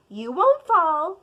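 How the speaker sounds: ordinary delivery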